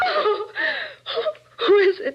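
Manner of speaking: high, sobbing fear; terrified. Acted. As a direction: gasp it out in terror. Gun at her back.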